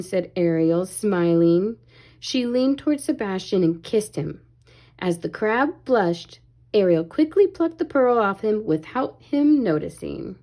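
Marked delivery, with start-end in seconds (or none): none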